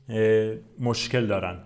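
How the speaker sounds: ordinary delivery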